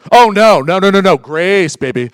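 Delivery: loud, sing-song mock holiness; mock pious reply. Preacher mocking a super-spiritual churchgoer, loud and sing-song. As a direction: ham up the spiritual answer.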